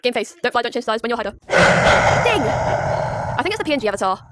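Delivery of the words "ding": high, sharp bell imitation; ding sound effect. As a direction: make a bell ding noise.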